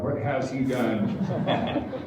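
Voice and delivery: mock-alarmed mom, slow and laughing. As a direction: laugh your way through the mother's worry. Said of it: "She goes".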